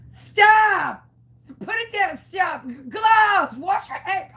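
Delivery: high yelled orders at the phone; retold shouting. Yelling at the phone as they make a tumbler, high and loud. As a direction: shout them down through the phone. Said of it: "I've shouted so much at my phone".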